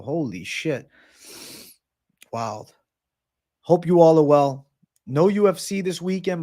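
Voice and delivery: ordinary delivery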